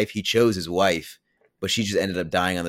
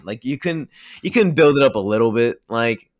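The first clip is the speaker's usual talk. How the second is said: louder, higher exasperated complaint; 4 semitones higher, 6 dB louder than usual. Real, not acted. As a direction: vent like the movie let you down. Frustrated rant about movie reveal.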